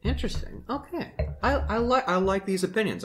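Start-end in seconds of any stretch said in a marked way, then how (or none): none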